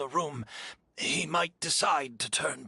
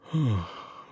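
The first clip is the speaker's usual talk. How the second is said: resigned sigh; 2 semitones lower than usual. Acted. Resigned sigh before a request.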